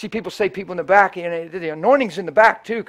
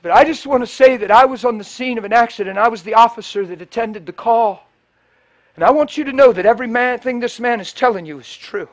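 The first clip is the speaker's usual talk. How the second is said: preaching at full force; 4 semitones higher, 10 dB louder than usual. Acted. Officer's testimony retold at preaching force.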